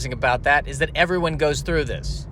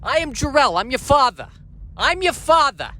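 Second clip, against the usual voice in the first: higher-pitched New York father; New York accent, emphatic and raised in pitch; Jor-El; 9 semitones higher than usual. Acted. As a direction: announce yourself as a New York dad. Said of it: "with a New York accent".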